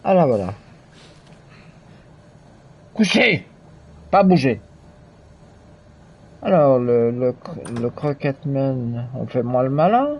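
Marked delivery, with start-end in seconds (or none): 2.9-4.6 short, high-pitched orders; mock dog command. Player ordering a beaten enemy like a dog, mock sharp command.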